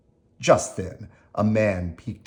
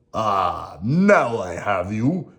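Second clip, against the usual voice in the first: slow, louder villain triumph; terrible shout; 5 dB louder, much slower than usual. Acted. Stromboli catching Geppetto in the trunk, loud and terrible. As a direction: gloat as you trap him, slow and loud. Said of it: "shouted Stromboli in a terrible voice".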